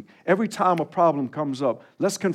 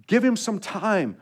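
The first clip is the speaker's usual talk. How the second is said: ordinary delivery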